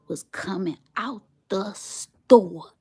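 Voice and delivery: slow hushed whisper. Real, not acted. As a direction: whisper it in awe. Hushed dream reveal.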